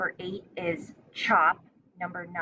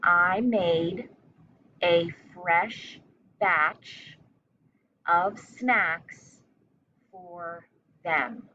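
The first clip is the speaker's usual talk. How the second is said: chunked into short phrases with pauses between; teacher dictating a sentence; slow dictation; much slower than usual. Shown as a demonstration. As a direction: dictate it piece by piece for young spellers. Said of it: "Now say it with me".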